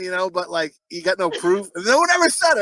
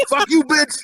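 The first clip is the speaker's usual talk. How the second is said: mock yell; 8 semitones higher, 4 dB louder, slower than usual. Husband voiced yelling at wife.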